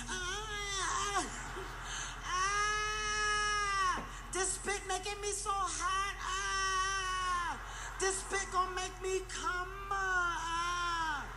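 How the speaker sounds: high, slow, hammed-up moans; comic moaning porn actress voice; mock porn moaning. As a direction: overplay a porn star's ecstasy.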